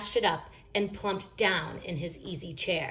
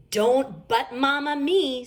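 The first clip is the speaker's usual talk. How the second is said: slow, firm, higher and louder scolding; stern Mama Bear; 7 semitones higher, 5 dB louder, slower than usual. A narrator's reading. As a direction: cut the child off firmly. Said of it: "said Mama Bear".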